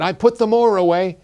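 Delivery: put-on boy showing off to his father; eager boy. A narrator's reading. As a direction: announce the chore with pride. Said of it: "the tall boy said".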